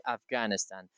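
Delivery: AFGHANISTAN with a hard g, "Av-GAN-istan"; mispronunciation to avoid. Shown as a demonstration. Teacher warns it is not appropriate for us, foreign mispronunciation. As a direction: say Afghanistan without the throaty ghayn, as foreigners do. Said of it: "it is not appropriate for us".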